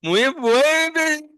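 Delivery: high, slow stuttering imitation of the student; mock stumbling tongue. Teacher mimics student's stumbling speech, high and drawn out. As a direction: mimic a tongue tripping over the word. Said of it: "तेरी जीभ लड़खड़ा रही है".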